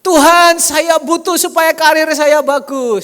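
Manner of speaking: loud, high, slow pleading prayer; mock prayer. Congregant praying for career, hammed-up loud plea. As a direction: cry out to God in a hammed-up plea. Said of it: "salah doa berarti".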